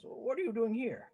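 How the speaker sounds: lightly voiced orc woman. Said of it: "this orc woman just says".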